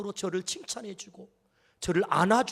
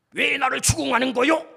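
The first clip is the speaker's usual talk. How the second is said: higher, louder snapping reproach; irritated retort; 5 semitones higher, 4 dB louder than usual. Retold or imitated. Husband snapping back at his wife, higher and louder. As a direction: snap it back, defensive. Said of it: "이렇게 했을 때".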